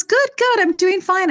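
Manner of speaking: breezy mother, unaware she forgot; elderly mother's voice. Retold or imitated. Mother answering her daughter's phone call, light and high. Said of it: "She goes".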